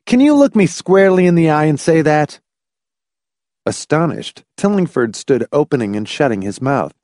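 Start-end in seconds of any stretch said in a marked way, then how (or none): 0.0-2.4 high, loud, challenging demand; heated shouted question; shouting. A narrator's reading. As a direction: confront him at the top of your voice. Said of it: "he shouted".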